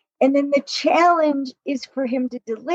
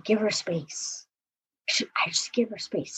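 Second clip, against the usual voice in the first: whisper; 3 semitones lower, 10 dB quieter than usual. Retold or imitated. Man imitated backing off.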